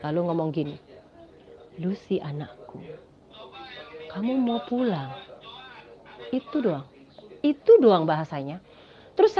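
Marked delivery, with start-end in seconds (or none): 1.8-5.1 a hint of a manly, commanding voice, slow; slow and grand, like a voice from heaven; lightly voiced God. Voice from opened sky asking the dreamer home, slow and grand. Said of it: "Suaranya laki-laki, gagah".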